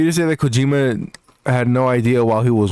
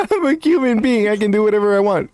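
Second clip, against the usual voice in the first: much higher, laughing; laughing mock quote; 10 semitones higher than usual. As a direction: quote it back while laughing. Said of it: "One more time".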